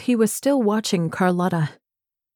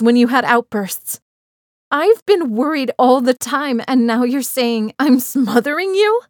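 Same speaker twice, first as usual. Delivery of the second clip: rising anger; 3 semitones higher than usual. Acted. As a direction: let the hurt boil over. Em's angry outburst at Benny.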